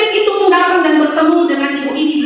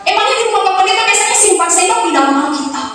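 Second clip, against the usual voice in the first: higher, protesting mother; hammed-up indignant mother, much higher; 8 semitones higher than usual. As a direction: scold the pastor, voice up high. Mother indignant about spoon in Bible.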